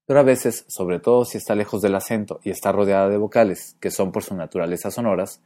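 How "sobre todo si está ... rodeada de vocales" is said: normal talking voice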